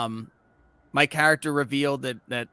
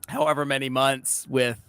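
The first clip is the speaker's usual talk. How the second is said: normal talking voice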